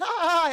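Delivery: high, loud put-on laugh; mock happy laughter. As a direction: play a delighted couple's laugh. Voicing a bonding couple's laughter.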